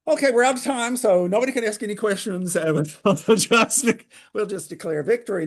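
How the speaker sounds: high, loud, gleeful wrap-up; jokey announcement. Real, not acted. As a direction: call time loudly, on the edge of laughter. Jokingly cuts off questions at end.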